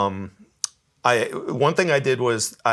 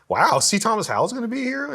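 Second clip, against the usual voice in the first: high-pitched starstruck question; retold amazement; 12 semitones higher than usual. Guest recalling news that the actor was coming, high amazed voice. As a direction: replay your amazed reaction, pitch leaping up. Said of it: "I was just like".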